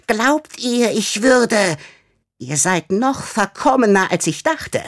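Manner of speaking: high, hissing scornful creature; mustache twirling villain. Acted. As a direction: sneer it like a little villain. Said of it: "zischte Flüsch".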